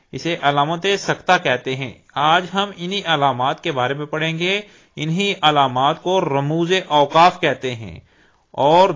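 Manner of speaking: usual speaking voice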